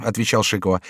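ordinary delivery, nothing marked

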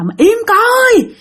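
high-pitched exasperated scolding; acted angry outburst, high but not shouted; cursing at a child. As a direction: snap at a crying child, pitch jumping up. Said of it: "cái chị mới chửi".

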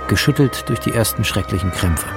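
usual speaking voice

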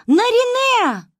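high, loud, slow drawn-out call; eerie shout through the woods; distant call. A narrator's reading. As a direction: stretch the name as a cry from afar. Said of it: "захлёбываясь криком".

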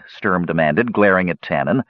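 usual speaking voice

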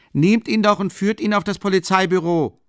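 high, loud interjecting townsman; pushy bystander calling out; petty bourgeois butting in. A narrator's reading. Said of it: "warf der Kleinbürger ein".

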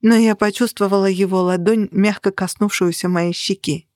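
usual speaking voice

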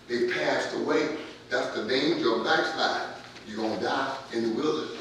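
ordinary talk

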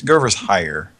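ordinary talk, nothing marked

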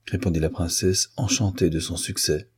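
ordinary delivery, nothing marked

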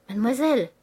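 appel fort et haut; s'écrie. Acted. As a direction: interpeller d'un air effaré. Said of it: "s'écria-t-il". (appel effaré et fort)

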